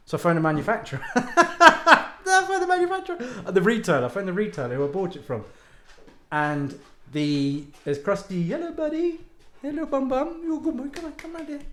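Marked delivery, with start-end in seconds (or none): 1.1-3.2 high, slow laugh through the words. Real, not acted. Laughing while telling of phoning the manufacturer. 8.5-11.6 high sing-song pet voice; dog talk. Real, not acted. Calling the dog Krusty over in a high pet voice.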